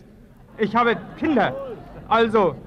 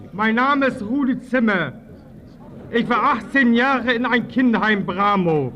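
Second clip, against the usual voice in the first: ordinary talk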